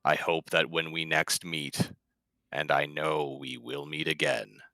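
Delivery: earnest Stone in character. Acted. Player as Stone gently consoling the unnamed creature, low and slow. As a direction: speak slowly and gently to a grieving creature.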